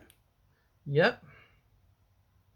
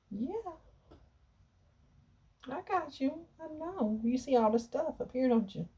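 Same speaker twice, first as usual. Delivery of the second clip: much higher and softer cooing; 7 semitones higher, 5 dB quieter than usual. Real, not acted. Owner soothing the cat in her arms, soft high cooing. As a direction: murmur sweetly to the cat.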